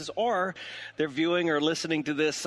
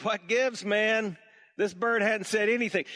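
higher and louder, fed up with the bird; exasperated customer; 8 semitones higher, 3 dB louder than usual. Acted. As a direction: complain like a cheated buyer. Customer complains again.

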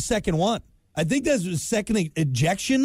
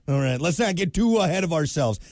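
normal talking voice

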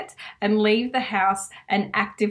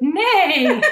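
normal talking voice

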